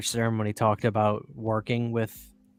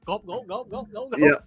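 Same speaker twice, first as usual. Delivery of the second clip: mock gulping; 8 semitones higher than usual. Imitating gulping a drink.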